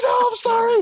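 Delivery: high, wailing mock sob of a scared kid; bawling kid. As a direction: plead like a crying little boy. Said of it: "I started bawling my fucking eyes out".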